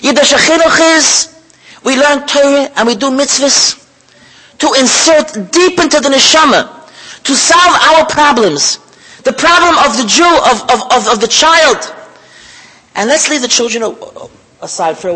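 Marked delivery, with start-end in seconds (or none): 0.0-11.9 loud, slow emphatic preaching. Acted. Preacher teaching the congregation, emphatic and slow. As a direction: drive the point home slowly.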